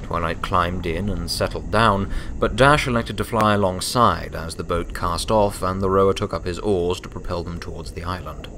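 ordinary delivery, nothing marked

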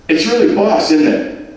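bubbly Scouse tourist chatting up a stranger; Liverpool man. Acted. Liverpool fan voiced by narrator.

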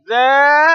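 high, loud, held ذا; long alif. Shown as a demonstration. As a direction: stretch the aa of ذا loudly. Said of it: "مد الألف".

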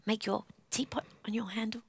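quick near-whisper; hushed. Real, not acted. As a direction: whisper the instruction as a get-ready cue. Hushed get-ready before singing again.

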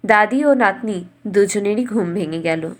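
usual speaking voice

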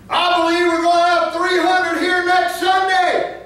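loud, high proclamation; proclaiming. Real, not acted. Preacher proclaiming next Sunday's attendance, loud and high. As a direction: announce it to the whole congregation. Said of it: "bold prediction".

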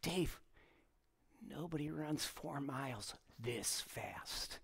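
deadpan, almost under the breath; mild mock scolding of a friend, quiet; dry aside. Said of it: "I'm like".

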